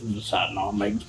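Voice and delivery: low, soft mutter under the breath; father grumbling about making maps. Acted. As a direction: grumble to himself while going. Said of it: "Jimbo grumbling".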